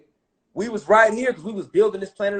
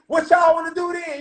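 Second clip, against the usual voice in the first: shouted trash talk; taunting challenge; 8 semitones higher than usual. Retold or imitated. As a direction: goad them into a fight. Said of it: "You know what I'm saying?".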